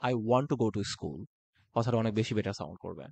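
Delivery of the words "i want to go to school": "want to" said slowly, every word separate; clear and slow. Shown as a demonstration. Teacher showing the clear version: slow "want to". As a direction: say "I want to go to school" slowly and crisply. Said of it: "আপনি বলতে পারেন".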